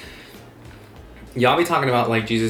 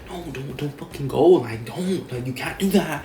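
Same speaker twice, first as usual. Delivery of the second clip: urgent hushed warning; 5 semitones higher than usual. Retold or imitated. Imagined pleading warning from mom.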